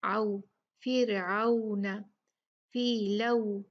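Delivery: slow आउ and लऊ ending on rounded u; rounded waw glide. Shown as a demonstration. Teacher modelling words with leen, rounded glide in आउ and लऊ. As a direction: draw out the a-to-u glide in आउ and लऊ. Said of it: "गोल करने होंगे".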